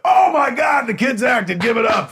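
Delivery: high, loud hype-man yell; hyped host shout. Retold or imitated. Director hosting the set cues applause for the kids, hyped shout. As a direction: shout it like a host whipping up a crowd. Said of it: "I host whatever I'm working on".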